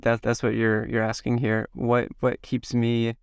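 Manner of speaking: ordinary talk, nothing marked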